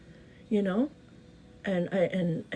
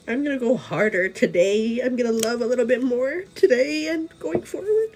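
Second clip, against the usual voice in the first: rising determination; 4 semitones higher, 5 dB louder than usual. Retold or imitated. Voicing her resolved inner self.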